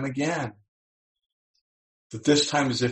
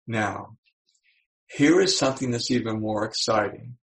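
ordinary delivery

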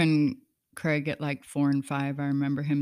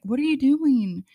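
higher, louder demanding question; alarmed demand; 9 semitones higher, 6 dB louder than usual. Retold or imitated. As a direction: confront him in alarm. Mother confronting husband, retold.

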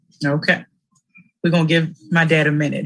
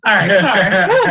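slow, loud, laughing apology; aunt laughing at her nephew; 6 dB louder, slower than usual. Real, not acted. Laughing after squeal.

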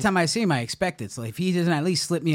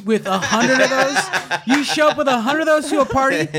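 laughing; 8 semitones higher, 4 dB louder than usual. Real, not acted. Laughing at taco story.

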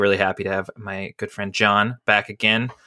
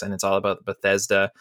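ordinary talk

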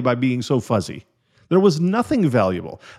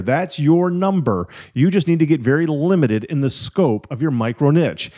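ordinary delivery

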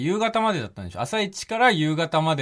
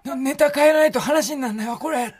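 high, strained mock of a blown-out voice; imitating lost voice; 10 semitones higher than usual. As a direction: mimic your partner with no voice left. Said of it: "この声で、この声で、そう言ってたんです、あなた". (声が潰れた相方の真似)

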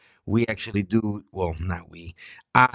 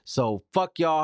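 irritated; 6 semitones higher, slower than usual. Real, not acted. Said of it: "I'm irritated".

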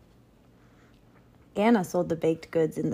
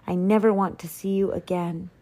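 plain reading voice

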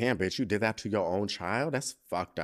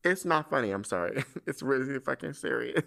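laughing; 2 semitones higher than usual. Real, not acted. Laughing while calling it serious.

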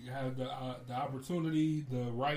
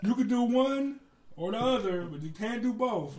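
higher, insistent no no no, slowing at the end; exasperated lecture; 6 semitones higher, much slower than usual. Retold or imitated. Scolding the friend in his head, higher and insistent. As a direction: lay down the rule like a fed-up parent. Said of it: "I'm like".

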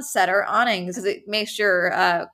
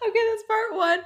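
amused high reaction; 13 semitones higher than usual. Real, not acted.

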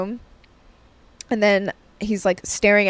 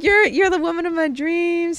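high-pitched imitation of a smitten admirer; mock suitor; 8 semitones higher than usual. Gym guy wooing the married woman, high mock-smitten voice. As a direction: play the swooning suitor, high.

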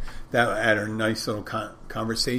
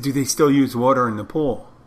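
repeating it louder so she hears; louder second try at the question; 2 semitones higher, 6 dB louder than usual. Retold or imitated. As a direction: say it again, louder, for someone who missed it.

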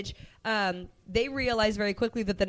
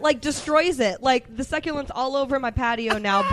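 ordinary talk, nothing marked

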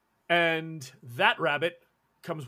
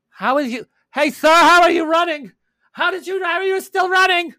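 high, loud baffled yelling; incredulous shouting; 15 dB louder than usual. Acted. Game master as an onlooker at the fleeing runner, shouting incredulously. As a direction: yell it in frantic disbelief.

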